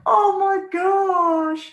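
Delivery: tearful cry, high and loud, slowed right down; choking up as the words stretch out; breaking into tears; overwhelmed, crying. Retold or imitated. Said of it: "And I was just like".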